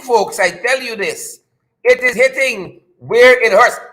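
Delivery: usual speaking voice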